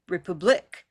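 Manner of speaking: "republic" as "repub-LIK", rising at the end; wrong last-syllable stress. Shown as a demonstration. Teacher showing another misplaced stress, "republic" as repub-LIK. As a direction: throw the stress onto the final "lic" of "republic". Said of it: "notice that it's one stress".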